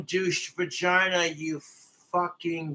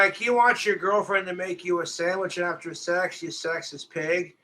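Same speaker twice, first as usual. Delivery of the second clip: usual speaking voice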